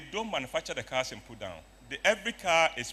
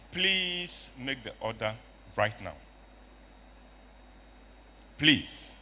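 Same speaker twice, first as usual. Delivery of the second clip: insistent plea; slower than usual. Retold or imitated. Urging the accountant to order.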